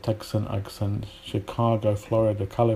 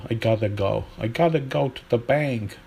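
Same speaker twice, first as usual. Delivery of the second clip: American accent. Shown as a demonstration. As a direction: flap the t of "gotta" like an American. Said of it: "in American English, they deal with it".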